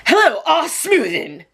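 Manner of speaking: high, slow, pushy put-on voice; aggressive caller voice. Mystery caller greeting her on the phone, aggressive put-on voice. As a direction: imitate a strange caller's thick, aggressive greeting. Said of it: "In that accent, with that kind of, like, aggression".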